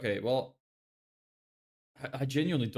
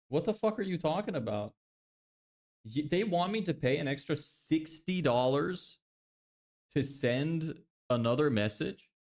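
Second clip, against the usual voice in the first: outraged disbelief; 6 semitones higher than usual. Real, not acted. Outraged at paying more.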